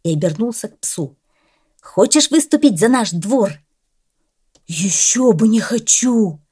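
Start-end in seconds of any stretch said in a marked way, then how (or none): 1.8-3.5 eager offer. Acted. Cat asks dog to compete. 4.7-6.4 slow, higher, grinning dog voice with a falling close; the dog beams, all eager: ещё бы не хочу; «Ещё бы не хочу!» drawn out slowly and grinning; beaming dog. A narrator's reading. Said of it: "расплылся в улыбке пёс".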